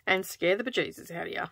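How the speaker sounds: ordinary talk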